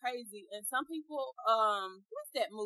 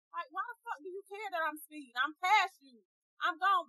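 normal talking voice

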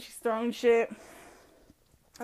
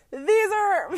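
ordinary talk, nothing marked

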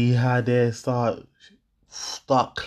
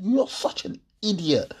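quoting the insult again; 8 semitones higher than usual. Retold or imitated. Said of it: "that little word you just said".

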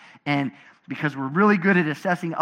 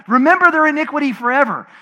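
angry prayer; 8 semitones higher, 6 dB louder than usual. Retold or imitated. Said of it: "he would get so mad at people".

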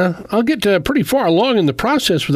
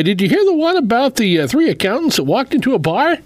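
joke setup; 8 semitones higher than usual. Acted. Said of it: "speaking of humor".

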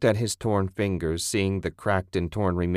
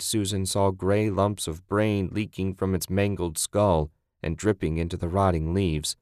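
usual speaking voice

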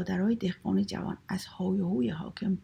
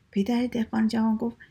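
ordinary delivery, nothing marked

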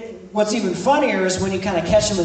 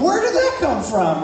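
higher, amused wondering; mock surprise; 6 semitones higher than usual. Pastor describing the mother's switch, mild mock surprise. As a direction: marvel at the sudden switch. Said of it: "it's like".

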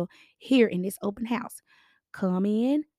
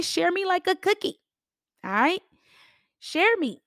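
playful plea; 8 semitones higher than usual. Real, not acted.

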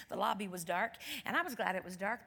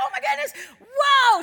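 high, loud whoa; hammed-up shrieking shock; astonished outburst; 16 dB louder than usual. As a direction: cry out in amazement. Astonished reaction in retold talk.

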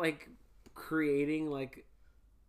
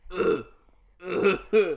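mock moaning; 9 dB louder than usual. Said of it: "I'm gonna go".

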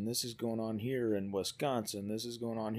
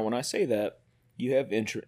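usual speaking voice

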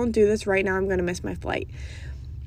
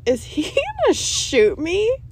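much higher, slow, half-laughing fright; laughing mock panic; 10 semitones higher, 4 dB louder than usual. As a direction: ham up the terror with a laugh. Cop reaching for his hip.

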